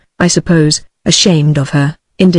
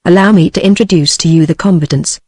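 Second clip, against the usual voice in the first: plain reading voice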